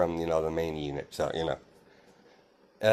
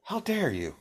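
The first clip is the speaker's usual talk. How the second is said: mock outrage; 10 semitones higher, 4 dB quieter than usual. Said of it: "Inko's like".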